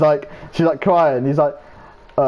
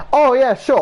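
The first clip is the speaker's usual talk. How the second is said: eager; 9 semitones higher, 4 dB louder than usual. Retold or imitated. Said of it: "He's like".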